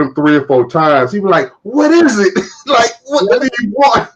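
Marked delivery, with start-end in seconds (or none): 1.6-2.4 high, exasperated imitation of snapping back; mock yell. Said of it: "Paper yell at you". 2.7-4.1 high-pitched, annoyed imitation; mock yell. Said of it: "Paper yell at you".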